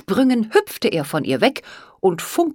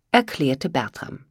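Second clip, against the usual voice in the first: usual speaking voice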